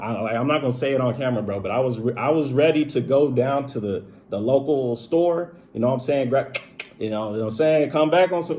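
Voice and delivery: real fury spilling out loud; heated. Real, not acted. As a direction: voice climbing as the anger comes back. Enraged retelling of breaking point.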